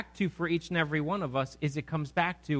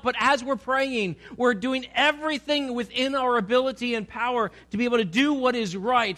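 rising, louder preacher's exhortation; preaching at full force; 5 semitones higher, 3 dB louder than usual. Acted. Preacher urging the congregation to work hard, forcefully. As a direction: drive the point home to the congregation.

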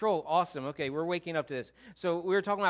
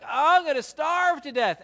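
hammed-up groan, much higher and louder; mock dying of hunger; melodramatic moan; 10 semitones higher, 10 dB louder than usual. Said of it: "I'm hunched over behind my desk like".

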